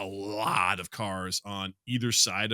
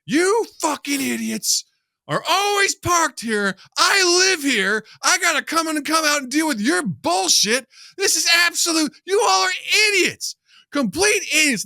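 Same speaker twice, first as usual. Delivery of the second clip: much higher, much louder, slow yelling rant; furious old man yelling; 9 dB louder than usual. Retold or imitated. Old neighbor screams at blocking protesters, furious slow yell. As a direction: scream it at them like you've had enough. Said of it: "laying into these protesters".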